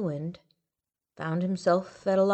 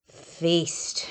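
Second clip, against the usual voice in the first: laughed. A narrator's reading. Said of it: "she laughed".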